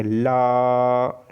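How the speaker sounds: ला held out with air around the tongue sides; lateral la demo. Shown as a demonstration. Teacher demonstrates the lateral consonant, drawn-out la. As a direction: sustain ला, letting the air slip past both sides of the tongue. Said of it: "ला बोल रहा हूँ".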